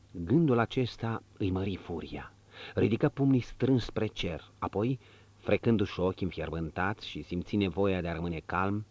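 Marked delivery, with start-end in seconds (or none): none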